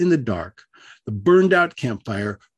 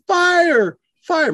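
high, loud alarm yell; acted high-pitched shout of alarm; 7 dB louder than usual. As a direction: yell it out in sudden panic. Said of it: "I started yelling".

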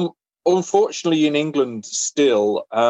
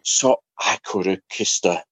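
usual speaking voice

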